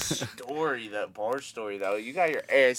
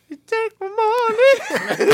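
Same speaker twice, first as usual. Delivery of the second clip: very high, drawn-out mock scream; mock wailing shout; 10 dB louder, much slower than usual. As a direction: scream it like a kid behind a slammed door. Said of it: "all he hears".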